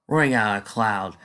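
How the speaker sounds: usual speaking voice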